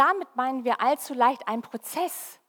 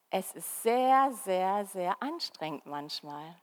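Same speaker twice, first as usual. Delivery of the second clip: slow emphatic sehr; 4 semitones lower, 4 dB quieter, slower than usual. Shown as a demonstration. Drawn-out repeated sehr.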